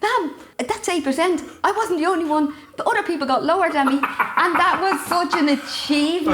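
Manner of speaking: high-pitched, earnest schoolboy protest; retold indignant defence. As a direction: imitate a son proudly arguing his case. Son defends his terrible exam mark.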